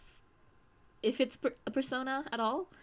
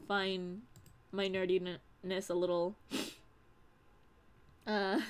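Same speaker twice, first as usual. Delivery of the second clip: usual speaking voice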